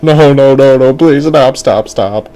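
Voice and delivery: loud, high, urgent begging; panicked kid. Acted. Boy begs fortune teller to stop, loud panic. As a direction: blurt it out like a frightened boy. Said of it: "And the kid was like".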